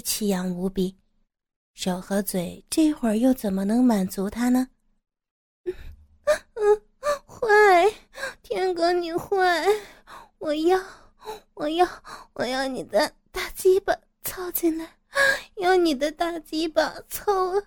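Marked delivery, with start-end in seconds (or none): 5.6-17.6 high, moaning female character voice; aroused pleading. Acted. Woman gives in to her teasing lover, aroused pleading. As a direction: whine and beg in a high voice.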